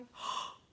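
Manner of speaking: a quick startled gasp; scared gasp. Shown as a demonstration. Teacher showing the scared face to children, gasping. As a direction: gasp like something scared you. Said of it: "Put those eyebrows up high".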